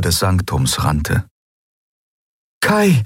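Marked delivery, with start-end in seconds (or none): 2.6-3.0 calls his name, high and carrying; woman calls for Kai through empty halls; calling out. Acted. Searching woman calling Kai's name. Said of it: "rief sie immer wieder".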